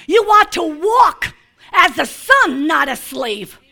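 ordinary talk, nothing marked

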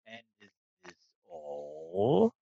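slow, booming dragon. Acted. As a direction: speak as an ancient proud dragon. GM voicing the dragon.

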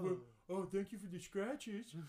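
high-pitched, grateful talking dog; goofy happy dog. Acted. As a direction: play a dog thanking its owner for petting. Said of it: "that's what a dog's voice is".

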